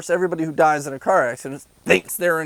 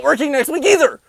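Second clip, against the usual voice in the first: exasperated outburst; 10 semitones higher, 5 dB louder than usual. Real, not acted. Ranting about rent.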